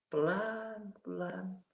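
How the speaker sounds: drawn-out, soft and falling; pelan. Retold or imitated. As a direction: let each word sink slowly. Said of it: "saya diturunkan pelan". (pelan, lembut dan makin turun)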